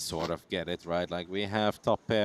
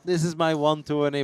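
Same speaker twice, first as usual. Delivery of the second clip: mock anxious player; 6 semitones higher, 5 dB louder than usual. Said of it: "then it's like".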